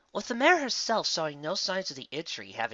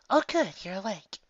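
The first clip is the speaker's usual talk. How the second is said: higher, lightly voiced nurse, relieved; 8 semitones higher than usual. A narrator's reading. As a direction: greet the patient with relief. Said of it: "the relief in her voice".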